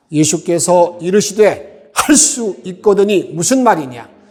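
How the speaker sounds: loud, steady congregational reading; read aloud together. Shown as a demonstration. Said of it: "큰 목소리로 함께 읽도록 하겠습니다". (회중과 함께 크게 또박또박 읽듯이)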